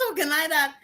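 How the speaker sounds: light Trinidadian lilt, rising questions; mock-puzzled Trinidadian voice; Trinidadian accent. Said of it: "I say".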